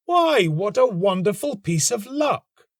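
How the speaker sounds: put-on a young man amazed at his luck; delighted cry. A narrator's reading. The student finding the rose at his window, delighted high cry. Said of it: "he cried".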